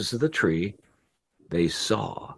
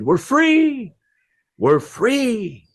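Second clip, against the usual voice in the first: high, loud joyful cry; jubilant pigeons; 4 dB louder, much slower than usual. A narrator's reading. Pigeons escaping the hunter's net, jubilant high cry. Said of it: "And they said".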